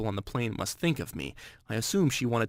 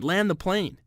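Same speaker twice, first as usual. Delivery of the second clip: higher, more desperate yell; screaming; 7 semitones higher, slower than usual. Retold or imitated. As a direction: push the panic up a notch. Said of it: "screaming at the top of my lungs".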